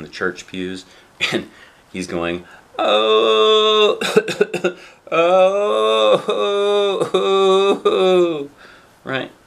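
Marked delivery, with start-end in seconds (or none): 2.8-8.5 loud, high drawn-out wailing "oh"s; mock crying. Reader imitates dad crying in church, loud mock wailing. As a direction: wail in sobbing bursts, hammed-up.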